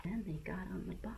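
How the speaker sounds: fast, secretive whisper. Acted. Sudden whisper in narration.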